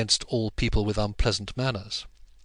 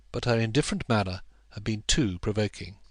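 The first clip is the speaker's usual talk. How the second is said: ordinary delivery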